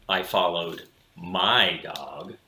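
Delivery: usual speaking voice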